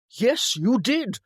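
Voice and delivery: high, loud, slow retort; insistent contradiction. A narrator's reading. Brother to denying sister, emphatic retort. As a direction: drive each word home in a squabble.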